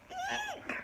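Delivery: very high scared squeak; frightened shriek. Acted. Princess frightened.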